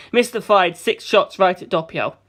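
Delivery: ordinary delivery, nothing marked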